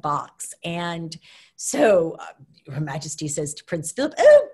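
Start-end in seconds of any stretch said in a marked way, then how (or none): 4.2-4.5 high, loud excited British voice; British accent. Retold or imitated. Queen calling Philip to see the pony gifts, excited British accent. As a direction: call to Philip like the delighted Queen. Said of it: "You got a great accent too".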